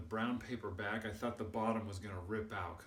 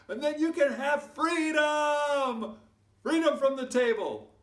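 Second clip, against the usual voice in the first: high, loud drawn-out victory cry; rallying shout; 12 dB louder than usual. Acted. Storyteller promises kids freedom from the table, triumphant shout. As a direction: shout it like a liberation speech.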